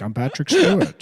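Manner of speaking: a mock impression with no British accent; bad Patrick Stewart. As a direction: parody a friend's flat Stewart. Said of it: "not even doing a British accent".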